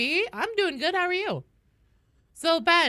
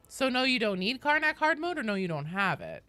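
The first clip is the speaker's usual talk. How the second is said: usual speaking voice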